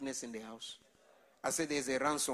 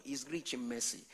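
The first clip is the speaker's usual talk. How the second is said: ordinary delivery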